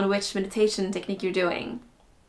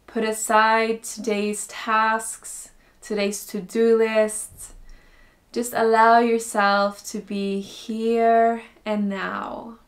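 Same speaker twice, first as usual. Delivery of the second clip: slow, calm meditation voice with long pauses; guided meditation. Acted. Teacher guiding beginners into breathing and stillness, slow guided meditation. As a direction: ease them in slowly, softening as phrases end.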